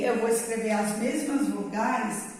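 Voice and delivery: ordinary talk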